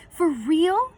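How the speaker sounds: high, loud and thrilled; can't believe his luck, voice jumping up; excited disbelief. A narrator's reading. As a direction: boyish excited gasp of a question. Connor thrilled at private screening.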